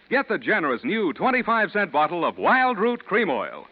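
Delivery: ordinary talk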